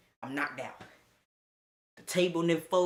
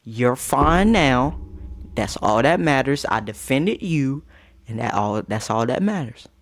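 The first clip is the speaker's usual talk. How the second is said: put-on reassuring girlfriend; 3 semitones lower, 8 dB louder than usual. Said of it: "She goes".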